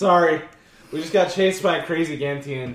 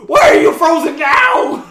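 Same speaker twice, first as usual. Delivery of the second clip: panicked shout; 12 semitones higher, 8 dB louder than usual. Acted. Frantic shout.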